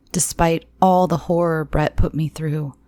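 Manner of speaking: ordinary delivery, nothing marked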